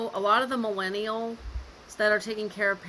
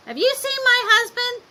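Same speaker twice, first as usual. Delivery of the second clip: loud, much higher-pitched imitation of mom; mother's urgent question; 8 dB louder than usual. Daughter voices mom looking for her husband, high urgent imitation. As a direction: call it out high, searching for him.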